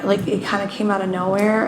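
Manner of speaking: normal talking voice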